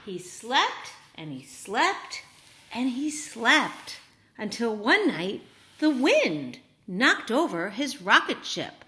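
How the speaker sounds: ordinary delivery, nothing marked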